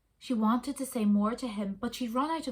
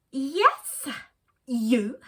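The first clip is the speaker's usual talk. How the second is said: louder, higher bird yell up at the dog; flamingo yelling; 3 semitones higher, 7 dB louder than usual. Acted. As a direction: shout up at the big dog, bold and small. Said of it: "the flamingo yelled up at the dog".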